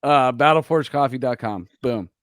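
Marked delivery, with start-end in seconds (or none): none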